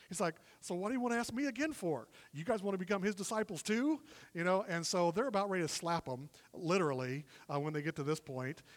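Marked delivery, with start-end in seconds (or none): 0.6-4.0 higher-pitched cheeky jab, rising at the end; snarky. Acted. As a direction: needle them with mock questions. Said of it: "he's getting snarky with them".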